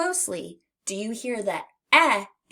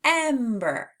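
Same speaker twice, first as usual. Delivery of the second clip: "Ember" with a punched opening "em"; clear test word; 5 semitones higher, 4 dB louder than usual. Shown as a demonstration. Teacher repeats "Ember" for listening. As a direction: stress the "em" of "Ember". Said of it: "beginning of ember".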